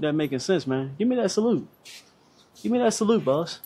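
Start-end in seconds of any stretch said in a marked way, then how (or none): 1.0-3.2 higher, hyped call to viewers; excited call-out. Real, not acted. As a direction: pump up the audience. Hyping up viewers.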